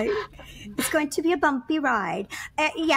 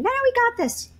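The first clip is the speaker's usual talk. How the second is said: mock staff; 12 semitones higher than usual. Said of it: "And I'm like".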